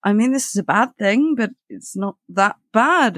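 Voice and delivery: hypnotized. Retold or imitated. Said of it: "he's just sort of this hypnotized".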